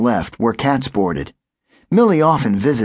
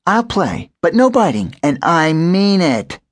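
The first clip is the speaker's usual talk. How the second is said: higher cat voice, firm on the end; stern cat; 7 semitones higher than usual. A narrator's reading. Millie agrees to play but warns the puppy. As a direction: lay down the rules.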